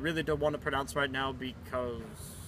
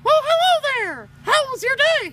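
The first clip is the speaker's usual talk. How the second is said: Mickey Mouse voice; 8 dB louder than usual. Pretend. Cartoon Mickey greeting.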